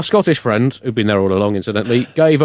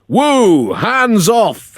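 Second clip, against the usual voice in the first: mock shout; 9 semitones higher, much slower than usual. Angry friend rebuffs a hug, mock shouting. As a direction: bark him away, comic alarm. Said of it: "he shouted".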